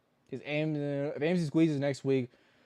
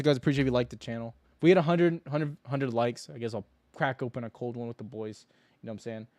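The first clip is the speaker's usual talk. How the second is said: usual speaking voice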